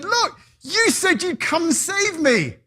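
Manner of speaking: high, loud angry call; drowned man complaining to God; indignant outburst. Acted. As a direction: confront God in outrage.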